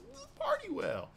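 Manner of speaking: squealed up high in delight; thrilled bartender gushing over Party Will; hyped. Acted. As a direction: gush like a starstruck fan. Hyping the character.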